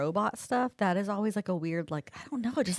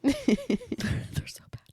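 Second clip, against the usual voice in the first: whisper; much slower than usual. Real, not acted. Laughing at her own sound effects.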